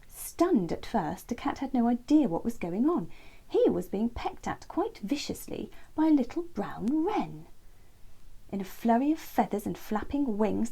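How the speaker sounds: ordinary delivery, nothing marked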